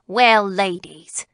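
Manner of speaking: lightly voiced county attorney, slow address; light pleasantry. A narrator's reading. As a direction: greet the women with slow, easy condescension. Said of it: "turning from serious things to little pleasantries".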